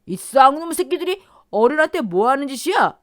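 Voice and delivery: high, loud furious yell; furious screaming. Acted. Brother-in-law screaming at the nephews, furious yell. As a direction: scream abuse at them. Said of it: "시동생이 악을 쓰자".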